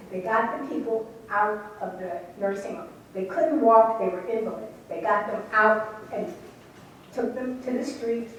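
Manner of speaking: ordinary talk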